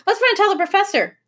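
high, fast, loud burst; hurried, excited suggestion; excited urging. A narrator's reading. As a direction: rush it out high and eager. Boy urging brother in story.

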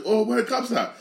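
high, mocking pitch on the quote; lightly mocking surprised resident; mock caller asking for cops. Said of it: "they'll say".

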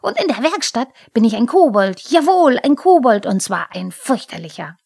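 loud, high, defiant threat; storming kobold's angry vow; Pumuckl. A narrator's reading. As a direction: declare it furiously on the way out. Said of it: "im Hinunterlaufen zischte er".

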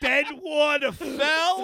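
high, slow mock British; hammed-up British show title; British accent. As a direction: drag it out in a put-on British accent. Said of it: "can't say these words without British accent".

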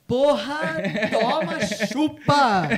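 high, loud gloating shout; gloating cheer. Real, not acted. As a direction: rub it in loudly. Gloating after lucky card.

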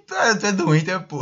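mock a friend who is cracking up; retold cracking up. Retelling his course friend's reaction to the story, amused. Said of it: "aí ele se acabou de rir".

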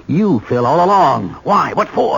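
rising to a furious shout; angry shout. Acted. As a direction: build from accusation to yelling. Confronting his would-be killer.

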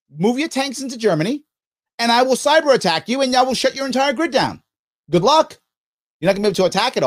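stern warning to Russia. Pretend. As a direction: warn the enemy coldly, one step at a time. Threatening Russia as leader.

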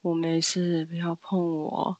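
low, very slow, distant mumble; eerie dazed voice. Retold or imitated. Possessed classmate answering worried girls, dazed and slow. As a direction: sound like someone not quite there.

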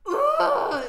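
loud, high "ugh"; exasperated groan. Real, not acted. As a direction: let out a big emotional groan. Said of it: "I'm getting em-, emotional talking about this".

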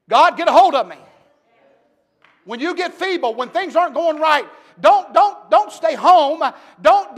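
loud, high preaching shout; preacher urging the weary congregation to church; preaching at full force. Acted.

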